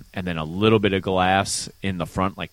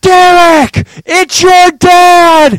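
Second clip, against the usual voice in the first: very loud, high, stretched-out yell; imitating shouting dad; 15 dB louder, much slower than usual. Son mimics dad yelling for his attention, drawn-out holler. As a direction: holler slowly to someone behind glass.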